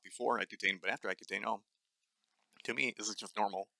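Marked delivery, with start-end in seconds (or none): none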